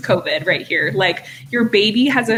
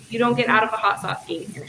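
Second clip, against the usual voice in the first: usual speaking voice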